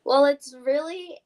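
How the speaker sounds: ordinary delivery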